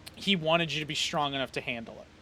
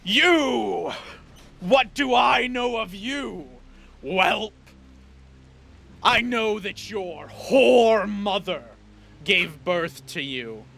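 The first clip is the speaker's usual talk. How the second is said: father shouting scorn at his son; furious shout; 3 semitones higher, slower than usual. Acted. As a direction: bellow his contempt down at the son. Villain father rages at son.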